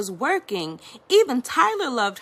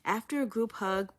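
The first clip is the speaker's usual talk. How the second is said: usual speaking voice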